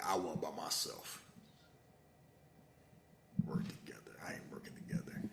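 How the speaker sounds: soft whisper of stubborn refusal. Retold or imitated. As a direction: hiss it like a secret. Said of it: "And she like".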